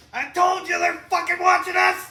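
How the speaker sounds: screamed high and loud, half laughing; paranoid shout. Pretend. Imagined outburst at a talking iPad, screaming. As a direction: yell it like the devices are spying on you. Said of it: "I would be like".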